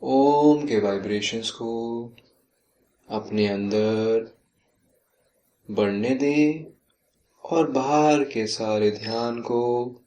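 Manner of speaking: slow yoga nidra voice, drawn-out words and long pauses; slow, measured meditation guidance with lingering phrase endings; guided meditation. Real, not acted. Leads yoga nidra relaxation.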